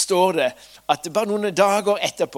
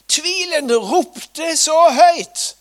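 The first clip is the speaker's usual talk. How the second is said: loud; 12 semitones higher, 11 dB louder, much slower than usual. A narrator's reading. Doubt shouting loudly.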